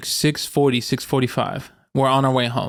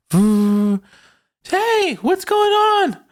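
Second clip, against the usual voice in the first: high falsetto holler, drawn out; mock calling out; much slower than usual. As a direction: imitate someone hailing a friend from afar. Partner calling out to friend, retold.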